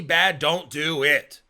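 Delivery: usual speaking voice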